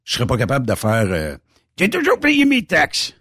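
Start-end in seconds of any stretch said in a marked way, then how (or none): 1.8-3.1 voix aiguë et forcée, bien plus haute; imitation moqueuse. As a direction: singe un contribuable trop honnête. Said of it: "Ça, je serais pas capable". (imite d'une voix haute un citoyen modèle)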